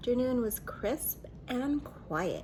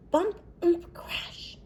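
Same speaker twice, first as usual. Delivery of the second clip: crash sound effects; 8 semitones higher, 7 dB louder, slower than usual. Acted.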